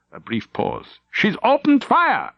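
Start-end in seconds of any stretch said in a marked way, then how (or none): 1.1-2.3 urgent high call, slow; alarmed call. Acted. Officer reports enemy firing.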